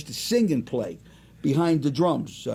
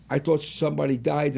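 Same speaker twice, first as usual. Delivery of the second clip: ordinary delivery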